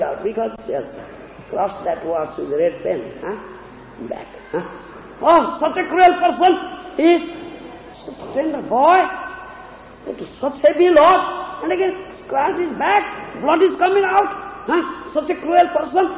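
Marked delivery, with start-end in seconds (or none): 5.2-16.1 indignant outrage. Acted. Wife indignant at husband's cruelty.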